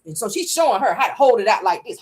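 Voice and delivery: normal talking voice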